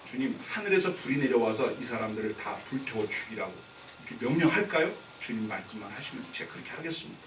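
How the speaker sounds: low, soft acted plea to the Lord; understated disciple's request. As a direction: put the request quietly, as a follower would. Disciples' request voiced to Jesus.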